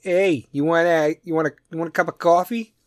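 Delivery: East Coast Jersey guy offering coffee; hammed-up Jersey "cawfee"; Jersey accent. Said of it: "Jersey East Coast accent".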